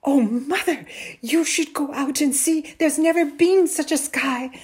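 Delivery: put-on high, loud, excited boy. A narrator's reading. Boy tells mother about the sky, excited. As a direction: burst out with amazing news. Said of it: "talking excitedly".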